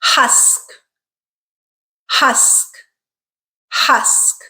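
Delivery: "Husk" said alone with a falling curve on the stressed uh; isolated "Husk", curved uh, for learners to copy; pronunciation model. Shown as a demonstration. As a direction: model "Husk" as a careful drill word, stressed uh. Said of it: "Notice it has that curve".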